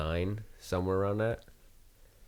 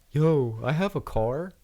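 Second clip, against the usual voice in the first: put-on drunk wonder; 5 semitones higher, slower than usual. Drunk friend discovering car.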